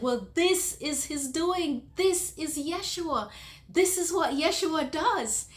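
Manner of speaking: high, loud, slow proclamation; exultant proclaiming. Retold or imitated. Telling the old lady who did this, exultant. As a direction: declare it high and loud, each phrase stretched.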